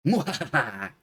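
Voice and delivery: hammy villain cackle; gloating mwahaha; evil laugh. Pretend.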